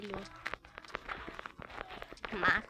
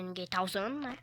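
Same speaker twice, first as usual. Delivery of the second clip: normal talking voice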